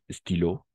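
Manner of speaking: "estilo" ending on an open o, no final s; silent final S. Shown as a demonstration. Teacher showing learners the plural, "estilo" with silent final s. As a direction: say French stylos as "estilo", dropping the plural s. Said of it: "igual aquí la S no se pronuncia".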